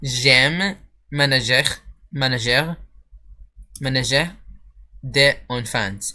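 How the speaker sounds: slow, careful French, each syllable drawn out, "j'aime ménager" stretched; carefully slowed French for a listener who did not understand. Shown as a demonstration. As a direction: spell out "ménager" slowly, rising on each try. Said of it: "So I'll say it slower".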